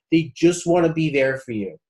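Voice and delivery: normal talking voice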